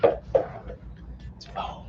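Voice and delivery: breathy whispered aside; hushed unvoiced aside. Real, not acted. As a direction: whisper it to yourself. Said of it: "whispered aside".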